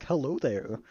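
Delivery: hammy celebrity impression greeting; put-on cartoon-man greeting; Patrick Warburton. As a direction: slip into an accidental actor impression. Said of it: "I keep doing fucking Patrick Warburton".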